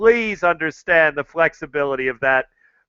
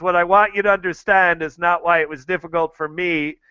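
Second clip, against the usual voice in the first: ordinary delivery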